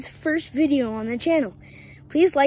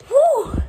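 high loud whew of relief; relieved whew; 12 semitones higher, 7 dB louder than usual. Real, not acted. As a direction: blow out relief. Relief after finishing.

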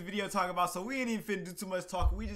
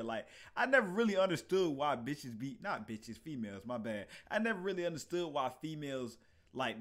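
ordinary talk, nothing marked

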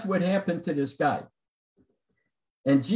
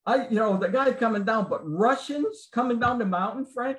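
disbelief; 6 semitones higher than usual. Retold or imitated. John protesting in disbelief.

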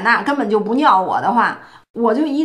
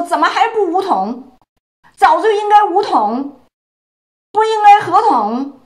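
raised, higher mock demand; rally the crowd's impatient cry, pitched up; higher, louder mock outcry; impatient public outcry; 6 semitones higher, 4 dB louder than usual. Voicing impatient public calls.

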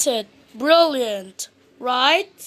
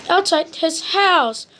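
ordinary delivery, nothing marked

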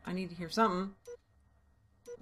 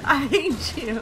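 louder, higher playful hate; loud mock hatred; 5 semitones higher, 12 dB louder, slower than usual. Real, not acted. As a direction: yell it at the player who killed you. Shouting at killer.

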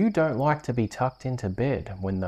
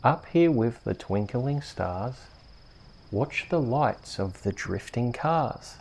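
bedtime story reading. Acted. Host reading a bedtime rhyme to a sleepy child. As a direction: lull a little one to sleep.

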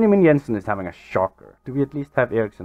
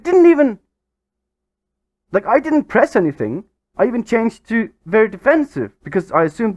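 much higher, louder outburst of disbelief; higher, louder incredulous rant at the screen; stunned disbelief; 10 semitones higher, 5 dB louder than usual. Real, not acted. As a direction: protest that the game cheated you. Goal suddenly taken away.

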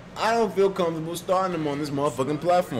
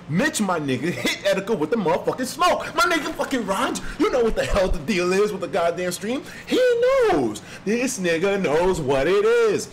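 high, excited hype-man delivery; fired-up celebration of a loyal fan; hyped shout-out; 6 semitones higher, 3 dB louder than usual. Real, not acted. Hypes up a donor's message.

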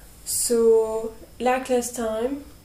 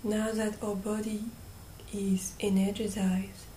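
guided meditation; 9 dB quieter than usual. Real, not acted. Guide leading listeners through breathing and calling on angels, hushed meditation voice. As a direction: gently guide them, leaving long silences between phrases.

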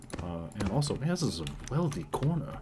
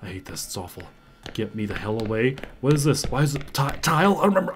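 ordinary talk, nothing marked

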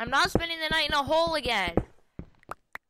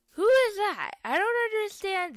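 startled; 9 semitones higher, 5 dB louder than usual. Real, not acted. Startled at a stranger.

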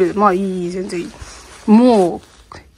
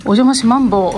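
usual speaking voice

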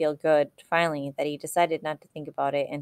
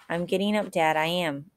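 ordinary delivery, nothing marked

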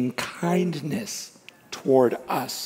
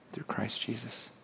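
hushed whisper to close God's words; 3 semitones lower, 15 dB quieter than usual. Acted. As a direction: let it fall to a breath. God's words trail to a hush.

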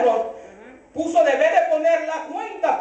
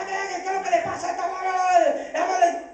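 mock shouting; 7 semitones higher, faster than usual. Pastor mimicking his wife yelling during his phone call, mock shouting. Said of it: "y gritando".

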